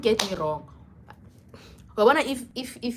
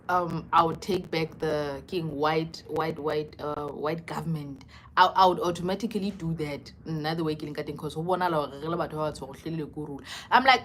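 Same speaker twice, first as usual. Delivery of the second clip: ordinary talk, nothing marked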